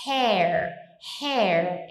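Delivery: "hair" said with an open vowel. Shown as a demonstration. Teacher repeating the open vowel of "hair". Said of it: "é aberto".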